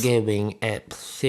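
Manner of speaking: slow, stretched silly voice; odd drawn-out voice bit; put-on voice. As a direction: drag the sounds out playfully. Resumes the put-on plosive voice.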